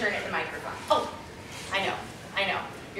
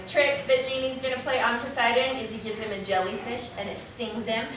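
ordinary delivery

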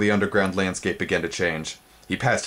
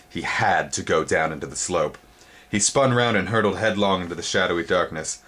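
ordinary delivery

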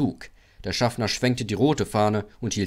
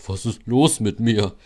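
ailing Gordon; 5 dB louder than usual. A narrator's reading. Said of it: "Ich fühle mich so schwach".

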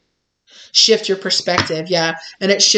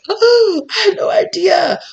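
much higher, laughing oh I had no idea; mock sheepish; 12 semitones higher, 3 dB louder, slower than usual. The exposed person to the client, high and laughing. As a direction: play the caught bully, embarrassed and giggling. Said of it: "this person was like".

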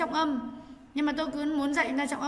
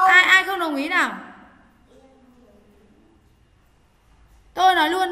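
louder impatient call; 8 dB louder than usual. Real, not acted. Teacher impatient with the class.

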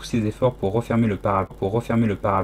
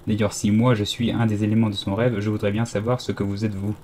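plain reading voice

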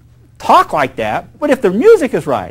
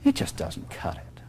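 whispered, unvoiced demo of playing soft; 13 semitones lower, 15 dB quieter than usual. Shown as a demonstration. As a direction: whisper it to show how weak soft sounds. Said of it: "If you play this song soft".

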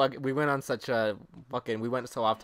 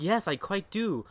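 slow, high-pitched, overdone politeness; mock proper speaker; 7 semitones higher, slower than usual. Kid proving he speaks English, slow mock-proper voice. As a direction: answer with exaggerated properness. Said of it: "You're like".

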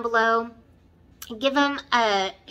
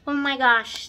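ordinary talk, nothing marked

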